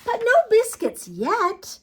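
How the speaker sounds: put-on high, loud, drawn-out child voice; little girl. A narrator's reading. Little girl holding biscuits back from puppy, high playful firmness. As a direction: gently forbid it as a storybook child. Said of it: "said the little girl".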